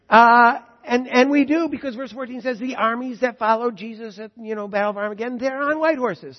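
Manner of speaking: loud, high, ringing sermon voice; full-force preaching. Acted. Preacher proclaiming scripture to the congregation, full force. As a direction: proclaim it to the whole hall.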